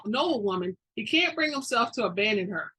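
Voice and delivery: usual speaking voice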